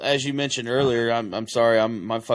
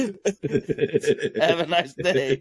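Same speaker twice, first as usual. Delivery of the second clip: slow laughing sign-off; 5 semitones higher, much slower than usual. Pretend. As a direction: say it slowly through laughter. Laughing mock-sweet sign-off.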